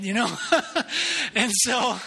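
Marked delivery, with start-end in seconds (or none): 0.4-1.3 a high burst of real laughter; big laugh. Real, not acted. Pastor laughing at his own confession to the congregation, a high laugh. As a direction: laugh out loud at your own punchline.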